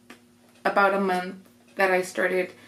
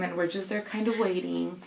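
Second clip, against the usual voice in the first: ordinary delivery, nothing marked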